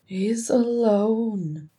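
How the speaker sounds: high, very drawn-out lament; mournful. A narrator's reading. Ori grieving that his brother is alone, mournful. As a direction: stretch it out in mourning. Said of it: "Ori mourned".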